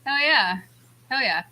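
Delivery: ordinary talk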